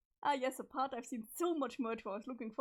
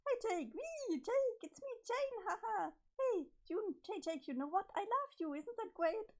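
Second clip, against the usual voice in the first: high, bubbly and overexcited; high-pitched manic sweetness; giddy Jane voice; 9 semitones higher than usual. Acted. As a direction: gush at Jake like a lovestruck girl gone strange. Voicing Jane's creepy confession.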